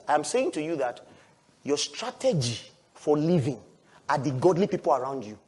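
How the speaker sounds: ordinary delivery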